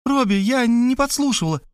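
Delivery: high, tearful protest. A narrator's reading. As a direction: plead innocence in a high, crying voice. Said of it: "заплакала сестра".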